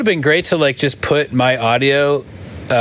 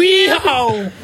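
Southern yeehaw; 14 semitones higher than usual. Acted. Southern bit.

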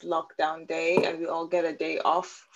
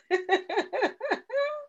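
evil scientist laugh; 13 semitones higher, 4 dB quieter than usual. Real, not acted. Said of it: "Your laugh is everything".

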